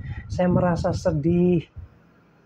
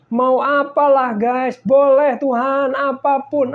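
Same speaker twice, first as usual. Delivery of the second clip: fervent cry; 7 semitones higher, 7 dB louder, slower than usual. Retold or imitated. Retold fervent prayer of surrender.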